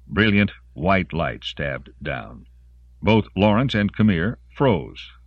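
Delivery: usual speaking voice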